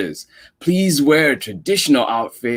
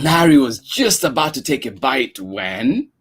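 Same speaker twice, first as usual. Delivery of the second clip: ordinary delivery, nothing marked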